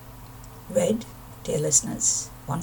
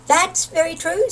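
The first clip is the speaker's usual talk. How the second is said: a lightly voiced small toad, higher; Toad's eager agreement; 9 semitones higher, 5 dB louder than usual. Toad eagerly backing Owl up, lightly voiced. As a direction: pipe up brightly in agreement.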